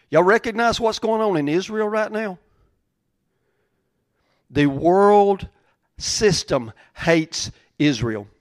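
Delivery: ordinary talk, nothing marked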